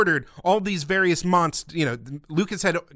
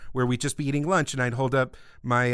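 ordinary talk, nothing marked

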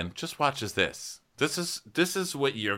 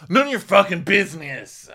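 loud, high snapped retort; mock-furious, high and loud; angry outburst; 10 semitones higher, 8 dB louder than usual. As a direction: blow up at a nosy friend. Acted angry outburst in a quarrel.

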